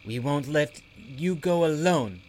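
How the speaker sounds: lightly voiced worried creature; Bobble voice. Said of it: "Bobble added".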